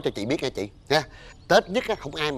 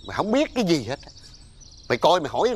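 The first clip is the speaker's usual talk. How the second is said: ordinary delivery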